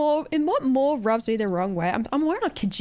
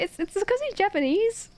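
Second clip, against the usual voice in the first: mock explanation; 11 semitones higher than usual. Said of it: "And I was like".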